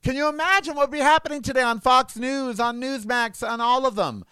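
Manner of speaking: high-pitched, indignant rant; real outrage, pitched well up; angry. Real, not acted. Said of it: "I'm too angry about what happened today".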